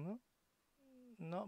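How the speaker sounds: usual speaking voice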